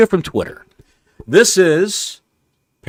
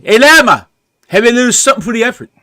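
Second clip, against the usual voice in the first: much higher and louder, rushed hammed-up caddie voice; mock Caddyshack caddie; 9 semitones higher, 5 dB louder, faster than usual. Co-host quotes Caddyshack to Don, loud and high-pitched. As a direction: ham it up like the movie caddie. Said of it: "And he said".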